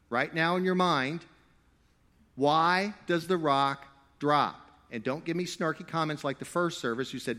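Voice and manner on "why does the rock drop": slow, each word laid out; slow pointed question. Shown as a demonstration. Preacher poses a puzzle to the congregation, slow and pointed. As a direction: make them think, drawing it out.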